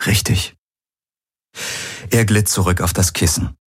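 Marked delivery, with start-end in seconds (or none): 0.1-0.6 slightly subdued agreement; mildly half-hearted confirmation; attempted smile. A narrator's reading. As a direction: agree through a tentative smile. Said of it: "Sie versuchte ein Lächeln".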